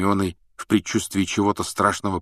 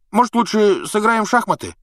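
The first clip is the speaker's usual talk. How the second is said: hasty; 6 dB louder than usual. Acted. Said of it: "поспешно предложил Рон".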